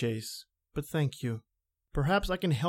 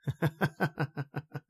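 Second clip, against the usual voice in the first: slow, measured villain's chuckle; sinister chuckle; 4 dB quieter than usual. Acted. Mysterious intruder to the startled writer, sinister chuckle. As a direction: chuckle to unsettle the other man.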